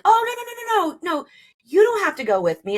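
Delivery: high, hurried imitation of her husband brushing her off; husband waving off his wife's offer, squeaky mock protest; mock nervous husband. Husband refusing her company, nervous.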